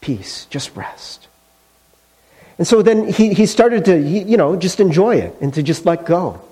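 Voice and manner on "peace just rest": hushed and gentle, as God's calming voice; soft soothing voice. Acted. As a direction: breathe it softly, almost a whisper.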